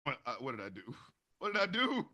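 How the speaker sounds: soft guilty mumble, head down; sheepish murmur. Retold or imitated. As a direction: mumble like a kid caught out. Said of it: "I'm putting my head down going".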